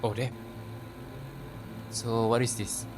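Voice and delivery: ordinary talk, nothing marked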